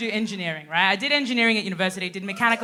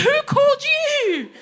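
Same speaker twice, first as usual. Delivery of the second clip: baffled neighbour; 6 dB louder, slower than usual. Retold or imitated.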